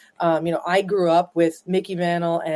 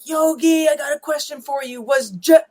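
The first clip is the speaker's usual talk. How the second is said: eager fan; 9 semitones higher, 5 dB louder than usual. Retold or imitated. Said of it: "they'd be like".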